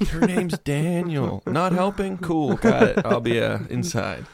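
high, drawn-out, self-mocking tone; mock chirpy. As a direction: play it breezy and embarrassed. Repeating the shouted line, self-mocking.

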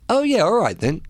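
airy high-pitched okay; high breezy agreement. Retold or imitated. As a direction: toss it off brightly, high. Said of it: "I said".

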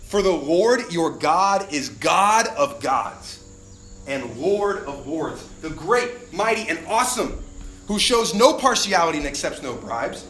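solemn scripture proclamation; scripture reading. Acted. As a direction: proclaim the verses with weight. Reads scripture aloud.

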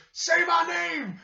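drawn-out, high, loud mock yell; retold shout. Host mimicking a wrestler walking off after he won the match, shouting. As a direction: imitate a wrestler bellowing slowly.

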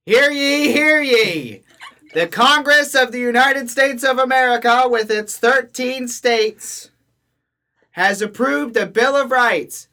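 loud, high town crier proclamation. Pretend. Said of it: "the same tone as it would have been announced by a town crier".

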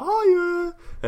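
overexcited squeal. Retold or imitated. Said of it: "I came in like".

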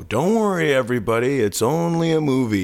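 high, sing-out reassurance to a crowd; imitated silly announcement. Friend standing up in a scary movie, imitated silly announcement. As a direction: goofily reassure everybody. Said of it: "you know, just something stupid".

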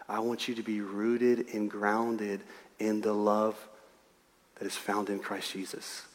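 very soft and low, intimate; tender, loving. Retold or imitated. God telling his child he loves them, tender and hushed. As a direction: say it gently, low, as a heartfelt confession.

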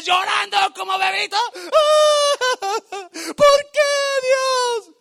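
high, loud hammed-up bawling; mock crying shout. Preacher imitating the crowd crying all night, loud mock sobbing. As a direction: wail like a bawling baby.

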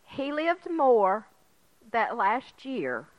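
ordinary talk

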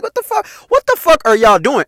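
high-pitched, incredulous scolding; worked-up retold outburst; exasperated protest. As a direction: act out losing patience, voice jumping up. Acts out his protest at friends.

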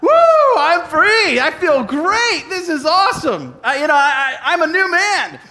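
high, loud burst of relief; relieved joy. Acted. Pastor acting out freed sinner, elated and loud. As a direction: shout it like the weight just fell off. Relieved after being forgiven, acted out.

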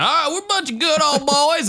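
high put-on Southern good old boy. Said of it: "Southern fucks be like".

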